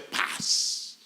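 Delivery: unvoiced, calm "Paz"; whispered peace. Mother refuses to wail and whispers peace. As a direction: let it out on a breath, no tears. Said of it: "No dijo: "Ay", a llorar, no".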